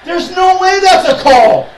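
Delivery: screaming, slow and outraged; furious shout. Real, not acted. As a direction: scream at the ref in fury. Raging at referee call.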